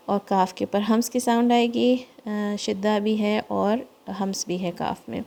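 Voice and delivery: usual speaking voice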